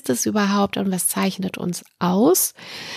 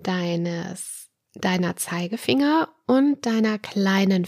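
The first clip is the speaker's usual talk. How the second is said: guided relaxation. Acted. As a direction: ease the listener into calm. Leads a guided relaxation exercise.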